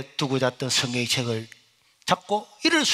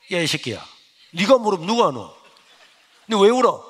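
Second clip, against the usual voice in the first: scolding teacher, louder and louder to a shout; angry teacher; 6 dB louder than usual. Retold or imitated. Homeroom teacher yelling at crying student, angry rising shout. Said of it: "담임 선생님이 저를 보더니".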